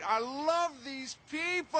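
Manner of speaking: çok daha yüksek, coşkulu bir bağırış; gleeful shout. Acted. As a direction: belt it out with mocking glee. Biker gleefully mocks the couple.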